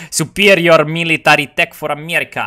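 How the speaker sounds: ordinary delivery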